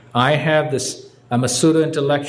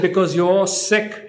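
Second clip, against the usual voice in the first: slow, weighty, a bit higher; emphatic; 4 semitones higher than usual. Retold or imitated. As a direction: lean on each word like a verdict. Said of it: "she said it with such emphasis".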